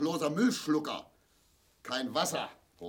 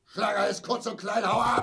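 angry shout; 8 semitones higher, 6 dB louder than usual. Acted. Father in pain yelling at his family to clear off, angry shout. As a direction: shout it high and loud, chasing them off.

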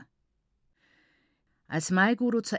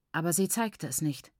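ordinary delivery, nothing marked

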